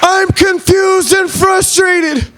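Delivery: very high, slow stretched voice; hammed-up high voice, dragged out; high put-on voice. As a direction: draw it out in a silly high voice. Cop and speaker exchange.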